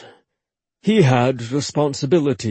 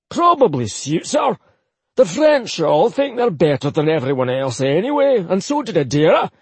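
higher, grumbling Scottish uncle; scornful old Highlander; 6 semitones higher than usual. Acted. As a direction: sneer about the French. Akir grumbles about Adara.

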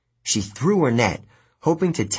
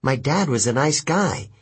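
usual speaking voice

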